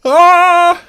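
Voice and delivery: sudden loud alarmed cry; startled shout. Real, not acted. River wipes out the landmass.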